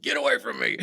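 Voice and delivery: high mock shout; retold shout. Woody shoving the angry boss away, high mock shout. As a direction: bark it out half laughing. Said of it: "Woody's like".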